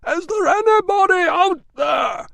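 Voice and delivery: very high, loud, slow shout; calling out. A narrator's reading. Catavignus shouting from the locked malt house. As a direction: call out desperately to be heard.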